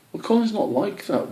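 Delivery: high, firm defence of a friend; defensive young squirrel. Acted. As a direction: stand up for your friend.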